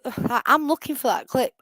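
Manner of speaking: ordinary talk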